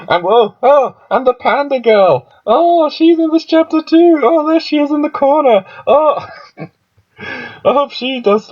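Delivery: light up at seeing her; excited squeal. Real, not acted. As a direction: fan squealing over a favourite character. Spots the panda girl, thrilled.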